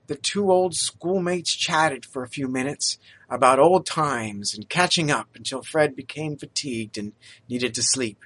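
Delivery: ordinary delivery, nothing marked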